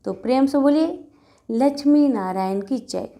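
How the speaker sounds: ordinary delivery, nothing marked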